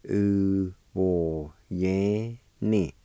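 "U, wo, yan, ni" in separate held syllables. Shown as a demonstration. Teacher showing learners the second part of handful, syllable by syllable. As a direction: draw out each sound of "U, wo, yan, ni". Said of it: "pronounced like this".

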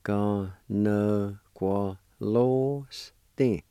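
Ganagualoste stretched out very slowly, syllable by syllable; very slow sounding out. Shown as a demonstration. Teacher to students learning Cherokee: very slow, stretched sounding out. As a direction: drag out Ganagualoste for learners to copy. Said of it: "And it's pronounced like this".

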